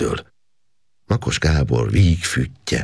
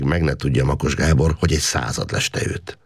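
plain reading voice

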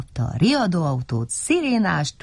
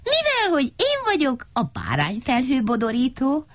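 high, proud declaration; high-pitched self-important little fellow; boastful character; 9 semitones higher than usual. A narrator's reading. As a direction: announce your grand title in a high voice.

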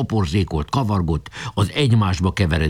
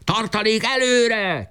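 high, slow, drawn-out call forward; shouted order; 14 semitones higher, slower than usual. A narrator's reading. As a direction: call the reserve forward, stretching it. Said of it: "kiáltott Boka".

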